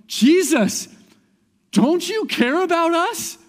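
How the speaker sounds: imitating panicked disciples, very high and loud; frantic panic. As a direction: yell it as a hammed-up desperate plea. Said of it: "they go to him in panic and they're frantic".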